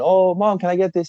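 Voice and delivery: put-on high, pleading little kid voice; mock child asking mom for a treat; imitating a kid. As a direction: play the begging child, pitched well up. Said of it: "this kid and his mom going".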